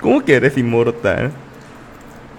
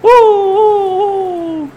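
much higher, louder rhythmic uh uh uh; excited hooting; 3 dB louder, much slower than usual. Real, not acted. Streamer hyped by a play, high excited hooting. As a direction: hoot like a fan as the play lands. Hype during a fight.

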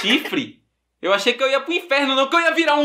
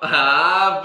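ordinary talk

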